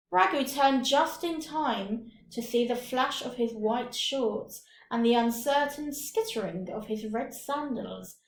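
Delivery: usual speaking voice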